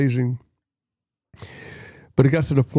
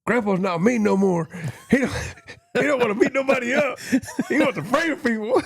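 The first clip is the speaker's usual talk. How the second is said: laughing; 10 semitones higher than usual. Real, not acted. Boys quoted, speaker laughing.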